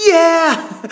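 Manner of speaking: high joyful whoop; excited cheer. Retold or imitated. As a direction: cheer like you just won. Cheering over their munchie haul.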